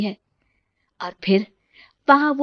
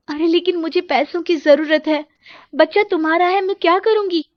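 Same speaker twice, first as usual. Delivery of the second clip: rising, pleading panic; begging her friend not to abandon the deal; desperate plea; 7 semitones higher than usual. Acted. Roli pleading with her friend on the phone, higher and panicked.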